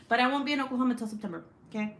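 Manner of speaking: ordinary talk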